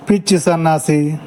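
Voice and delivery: ordinary talk, nothing marked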